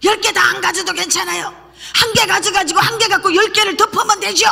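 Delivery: high-pitched, insistent haggling; arguing with God. Retold or imitated. Preacher acting out bargaining with God, high-pitched protest. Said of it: "막 어느 날은 이렇게 따졌어".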